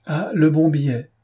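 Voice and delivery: the neighbour across the table exclaiming; exclaimed. A narrator's reading. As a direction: blurt it out with delight. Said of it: "s'écria le voisin d'en face".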